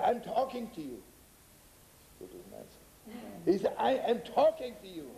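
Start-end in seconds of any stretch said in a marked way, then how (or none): 0.0-1.0 higher-pitched, insistent call; raised pitch, pressing for a reply; insistent retold address. Quoted man addresses silent listener, then repeats. 3.8-5.1 higher-pitched, more forceful demand; hammed-up yell for an answer; mock yell. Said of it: "when it hurts, you begin to yell".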